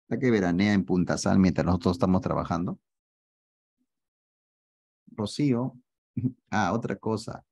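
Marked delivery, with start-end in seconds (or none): none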